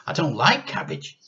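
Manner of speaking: put-on a small boy protesting; whiny boy. A narrator's reading.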